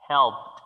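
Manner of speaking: helped said loud, ending in P-T; helped as one syllable, "helpt"; final T sound. Shown as a demonstration. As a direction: release a T after the P of helped. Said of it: "se finalizaría con una T".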